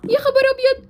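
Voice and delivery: high, urgent shout; alarmed calling out. Acted. Parent warning child about cars, alarmed shout. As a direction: call after a child running off.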